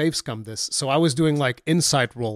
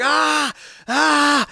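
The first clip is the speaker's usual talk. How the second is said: imitated scream of pain; 12 semitones higher, 4 dB louder than usual. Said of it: "she's screaming in pain".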